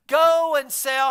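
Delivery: loud and slow, every word hit; emphatic. Acted. Preacher thundering Jesus's command slowly. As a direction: drive the words home.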